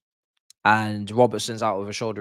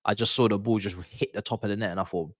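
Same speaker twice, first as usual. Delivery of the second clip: ordinary talk, nothing marked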